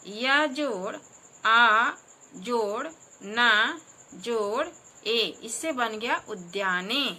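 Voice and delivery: syllables joined one by one, slow and emphatic; slow spelling out. Shown as a demonstration. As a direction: build the word aloud piece by piece, very slowly. Spelling syllables aloud slowly.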